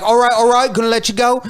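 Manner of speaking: put-on high Australian accent, settling at the end; Steve Irwin. Crocodile hunter character releasing his catch, high Australian impression. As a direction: soothe the catch in mock Aussie. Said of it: "let him go and be like".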